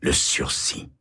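le sursis chuchoté, sans voix. A narrator's reading. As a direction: souffle le sursis comme un secret. Narrateur répète le mot, chuchoté. (chuchoté, sans voix)

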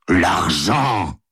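restrained angry exclamation. A narrator's reading. Husband rebukes his wife over money, angrily. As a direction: throw it out with contained anger. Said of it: "dit-il avec colère".

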